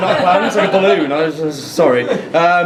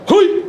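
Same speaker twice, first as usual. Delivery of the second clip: high loud startled hoi; startled Pacino-style yelp; 12 semitones higher, 3 dB louder than usual. Retold or imitated. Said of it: "it sounds like Al Pacino".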